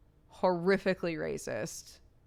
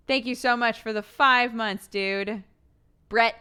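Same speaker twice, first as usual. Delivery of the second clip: ordinary talk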